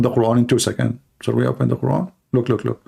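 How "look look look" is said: ordinary talk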